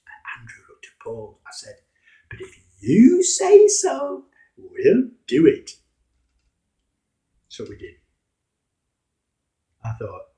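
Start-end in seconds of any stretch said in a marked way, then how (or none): 2.3-5.8 much louder, very slow, mock obliging; sarcastic. Simon grudgingly agreeing to Jesus, loud slow sarcasm. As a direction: lay the sarcasm on thick. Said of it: "In my best sarcastic voice".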